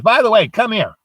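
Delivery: high, loud summons; old lady ordering. Acted. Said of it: "And she goes".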